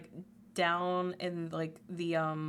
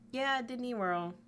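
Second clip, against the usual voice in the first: ordinary talk, nothing marked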